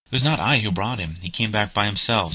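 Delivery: plain reading voice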